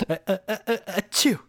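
high building fake sneeze; mock sneeze. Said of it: "he acts like he's about to sneeze".

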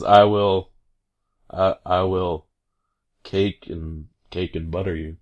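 halting, wooden, dropping away low; worst delivery. Retold or imitated. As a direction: stumble through it like a non-actor. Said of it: "he just does the worst delivery".

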